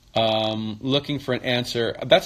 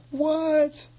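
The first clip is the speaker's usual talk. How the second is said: shocked fan reaction. Retold or imitated. Fans hearing the [name] show news, squeaky mock surprise. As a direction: imitate a stunned fan, pitch flying up. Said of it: "all the [name] fans were like".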